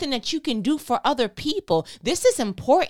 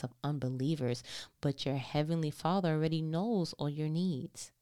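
calm, lower, measured Bible reading; scripture reading; 7 semitones lower, 9 dB quieter than usual. Acted. Reads scripture aloud.

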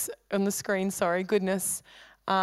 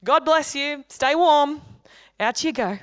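higher, louder, slow well-wishing send-off; cheery brush-off; 5 semitones higher, 4 dB louder, slower than usual. Retold or imitated. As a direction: imitate someone breezily wishing them well. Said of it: "they kind of were like".

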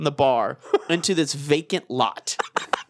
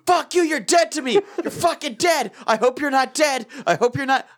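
high-pitched mock-furious rant; hammed-up voicemail outrage; retold angry stage; 9 semitones higher than usual. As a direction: rant in rapid mood swings, pitched up. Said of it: "all the stages of grief".

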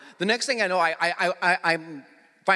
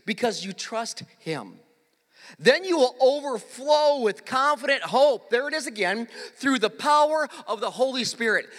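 scripture reading; 4 semitones higher than usual. Acted. Pastor reads the verse with growing force. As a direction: build the verse up toward the Spirit.

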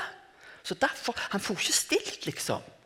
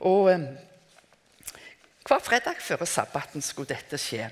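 normal talking voice

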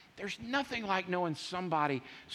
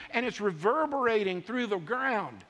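ordinary talk, nothing marked

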